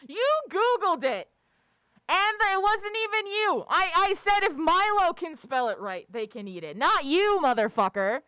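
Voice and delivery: loud, high, indignant outburst; indignant telling-off. Real, not acted. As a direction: call them out for cheating, loudly. Mock telling-off of someone who cheated.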